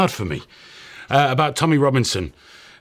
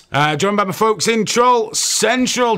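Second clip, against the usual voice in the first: usual speaking voice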